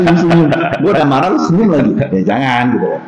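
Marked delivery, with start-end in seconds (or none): 0.0-1.9 mock exasperated outburst. Angry friend provoked by smiling.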